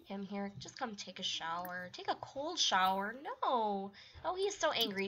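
ordinary delivery

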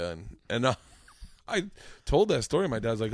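normal talking voice

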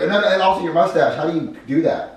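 high, loud character voice; urgent distraction. Shown as a demonstration. Junior stops the chef turning around, urgent. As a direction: blurt it to hold attention. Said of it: "Jeffy's gonna try to turn around".

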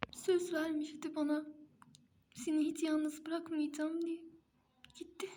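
high, choked voice of a grieving wife; wailing in disbelief; grief-stricken. Acted. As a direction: plead through the tears. Mother grieving husband's death.

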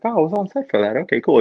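pleased realization. Retold or imitated. Acting own pleased reaction in cinema.

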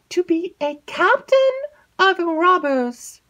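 high, halting stammer; nervous maid stammering out the news; hesitant maid. Acted. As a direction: stumble and stall before the secret. Annette reveals the secret.

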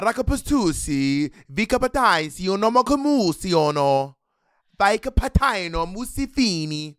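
sing-song mock Italian gibberish; fake Italian. Host does fake Italian gibberish for the bit. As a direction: rattle it off like a hammy Italian. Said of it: "fake Italian for me would be".

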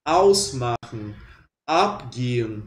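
"ausmachen" and "abgehen" with stress on the first syllable. Shown as a demonstration. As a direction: lean on AUS and AB, slowly. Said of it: "sempre vai colocar tônica nessa primeira sílaba".

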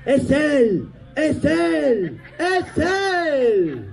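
fan shout demo. Shown as a demonstration. Showing the audience lady what to shout: excited fan cry. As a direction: cry it out like a starstruck fan spotting him. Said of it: "gritar algo así como".

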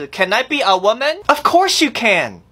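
ordinary delivery

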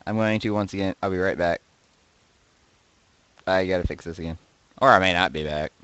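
ordinary delivery

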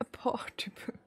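ordinary delivery

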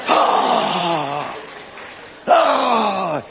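drawn-out imitation gasp of outrage; mock scandalized gasp. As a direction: gasp like a shocked critic. Mimics critics gasping at his bling.

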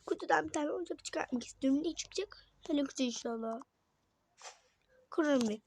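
ordinary talk

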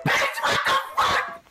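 very high, loud shriek, drawn out; shocked screech. Real, not acted. Vlogger recalling her fat old glasses, shocked screech. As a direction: screech it in disbelief.

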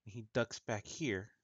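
ordinary delivery

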